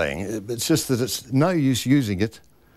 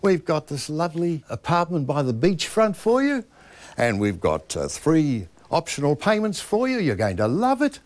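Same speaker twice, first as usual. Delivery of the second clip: lines end on a rise, as if asking; Kiwi rising inflection; 4 semitones higher than usual. Shown as a demonstration. Voice coach mocking Kiwi salespeople, endings rising. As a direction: tip every ending up like a question. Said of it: "For example".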